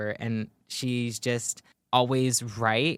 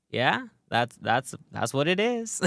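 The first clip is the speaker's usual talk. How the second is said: casual, unbothered character impression; nonchalant Scooby-Doo; 2 semitones higher, slower than usual. Fan imitating Scooby's reply to everyone acting insane, nonchalant. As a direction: lightly put on a shrug in the voice. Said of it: "nonchalant way".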